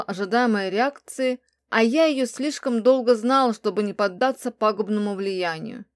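ordinary delivery

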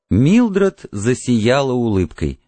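usual speaking voice

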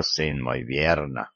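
"верно" sunk low and soft; old priest voice. Acted. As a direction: murmur "верно" deep and quiet like an old priest. Patriarch agreeing, low voice.

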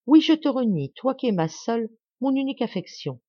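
disown her with rising pain; anguished renunciation. A narrator's reading. Brother disowns his only sister, anguished renunciation.

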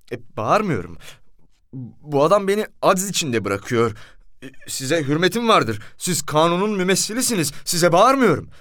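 aggrieved appeal to authority; protesting defence. A narrator's reading. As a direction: plead your case, rising in pitch as you go. Narrator defends himself to commissioner.

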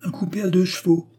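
put-on high, loud gossipy old widow; gossiping concierge. A narrator's reading. Concierge describes Eugénie to Léon, high loud gossiping voice. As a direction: pile on the scandal in a high voice. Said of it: "poursuivit la fille Pipard".

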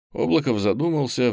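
ordinary delivery

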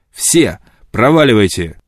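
loud order to get out; impatient command; curt dismissal. A narrator's reading. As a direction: throw her out with the words. Orders her out.

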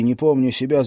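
ordinary delivery

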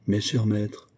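ordinary delivery

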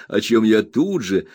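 usual speaking voice

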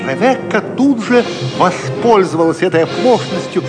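ordinary delivery

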